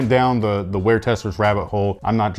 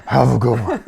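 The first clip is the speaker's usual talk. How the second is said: fake out of breath; 4 dB louder than usual. Shown as a demonstration. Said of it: "do it out of breath".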